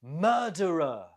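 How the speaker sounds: "Murderer" stressed on MUR, falling away after; stress on first syllable. Shown as a demonstration. Teacher models the word for learners, MUR stressed in "Murderer". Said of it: "The stress is on the first syllable".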